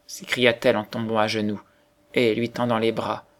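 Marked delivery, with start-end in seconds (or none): none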